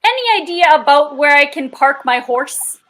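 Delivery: Canadian Mountie asking politely; Canadian accent. Acted. Player as a mounted officer seeking parking, Canadian accent. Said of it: "Good job on the Canadian accent".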